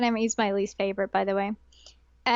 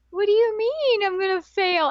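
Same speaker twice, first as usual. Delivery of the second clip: mock upset kid; 9 semitones higher, 4 dB louder than usual. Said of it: "And the kid's like".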